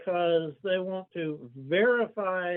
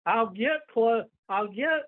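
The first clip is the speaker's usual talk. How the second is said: ordinary talk, nothing marked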